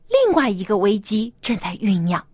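ordinary delivery, nothing marked

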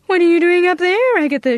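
startled, call up to her; alarmed mother. Acted. Mother finds daughter up the tree, alarmed call. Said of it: "she cried".

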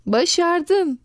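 teacher cheering a pupil; delighted praise. A narrator's reading. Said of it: "diye bağırdı öğretmenimiz".

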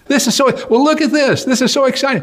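very high, thrilled squeal; excited young man. Acted. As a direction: gush with childlike delight. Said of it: "young man again".

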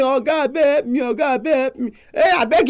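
high pleading with a crying edge; mock-tearful widow begging the judge for help. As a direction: beg the judge through tears. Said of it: "He come back again".

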